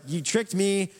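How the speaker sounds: high-pitched, indignant complaint; a touch of outrage; mock angry protest. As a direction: protest being cheated, lightly acted. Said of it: "super angry".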